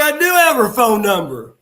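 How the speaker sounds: thrilled to say yes to the legend; excited yes. Retold or imitated. Telling Tommy Wildfire Rich excitedly that he has her number.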